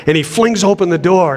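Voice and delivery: ordinary talk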